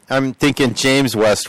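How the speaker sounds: ordinary talk, nothing marked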